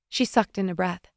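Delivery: ordinary delivery, nothing marked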